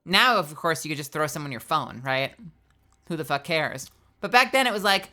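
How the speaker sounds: usual speaking voice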